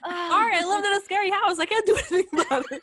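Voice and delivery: high, loud words through laughter; laughing apology. Real, not acted. Storyteller defends her scary house to friends, laughing. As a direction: protest helplessly while cracking up.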